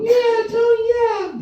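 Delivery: put-on squeaky high child voice; shrill happy kid answering. Kids answering Tony the Tiger, very high. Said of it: "They sit down".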